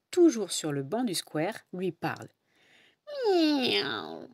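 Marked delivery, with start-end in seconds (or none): 3.3-4.3 put-on drawn-out meow of a sleepy old cat; long, lazy cat miaow.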